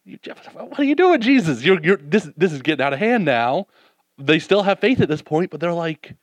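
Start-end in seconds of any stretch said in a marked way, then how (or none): 0.0-3.7 high, put-on voice of a fed-up disciple; high mock-exasperated follower. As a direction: play a disciple losing patience with his friend. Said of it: "Disciples were like".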